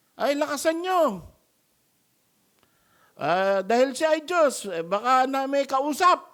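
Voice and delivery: high, loud taunting call to the crowd; mocking Elijah. Elijah taunting Baal's prophets, high mocking shout. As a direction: jeer at them, pitched well up. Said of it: "sabi ni Elijah".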